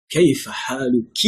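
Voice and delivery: كيف حالك with a high, stressed "kee" ending; feminine -ki ending. Shown as a demonstration. Teacher showing the form for a female, feminine -ki ending. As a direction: lean hard on a final "ki" in حالك. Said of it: "ki, when you are talking to female".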